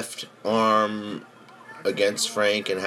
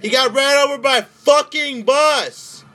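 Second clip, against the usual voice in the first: much higher, loud excited shout; 8 dB louder than usual. Real, not acted. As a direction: yell it in disbelief. Repeats it even bigger.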